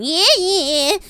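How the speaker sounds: high, loud creaky granny. Pretend. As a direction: cackle it like an old granny. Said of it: "the Granny Smith going".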